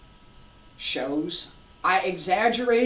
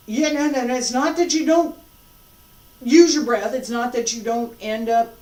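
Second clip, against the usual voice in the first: loud and emphatic, a bit higher; 5 semitones higher, 8 dB louder than usual. Real, not acted. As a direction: insist firmly, no no no. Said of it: "emphatic correction".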